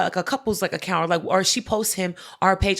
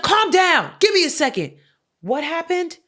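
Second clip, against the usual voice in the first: mock dismissive cop; 8 semitones higher, 3 dB louder than usual. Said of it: "he's like".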